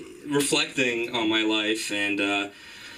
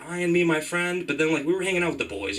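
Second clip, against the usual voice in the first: ordinary talk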